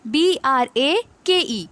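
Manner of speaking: slow letter-by-letter spelling; spelling out slowly. Shown as a demonstration. Teacher spelling a word for students, slow and deliberate. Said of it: "इसकी spelling देखो".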